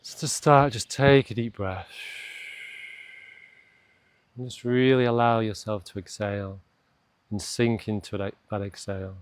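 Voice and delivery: unhurried meditation voice with long pauses; guided meditation. Acted. Coach slowing a stressed listener's morning to a pause, calm guided meditation. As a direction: guide them gently down into stillness, leaving space to breathe.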